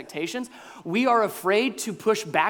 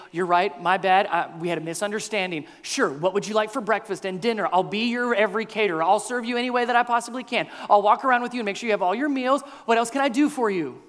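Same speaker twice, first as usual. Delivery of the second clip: eager, higher-pitched people-pleaser; hurried, over-accommodating apology; caving under pressure; 4 semitones higher, faster than usual. Acted. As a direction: rush to appease, apologising and offering. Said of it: "He could easily cave under this pressure".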